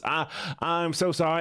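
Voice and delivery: put-on drawn-out Southern-accented apology. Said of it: "I'm gonna go back to the Southern voice".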